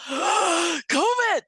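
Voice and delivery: high, hammed-up alarmed "covid"; mock panic. Mocking fearful people, mock panic.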